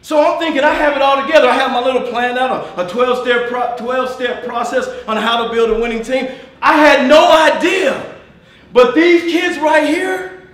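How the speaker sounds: loud, high, fired-up coach; all excited. Real, not acted. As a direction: shout it to the crowd, fired up. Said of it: "I didn't mean to get all excited".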